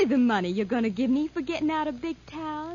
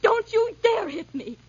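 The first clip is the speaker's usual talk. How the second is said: high, slow, raised-voice warning; frightened defiance; 8 semitones higher, 4 dB louder than usual. Acted. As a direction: dare him through rising panic. Cornered, about to be hit.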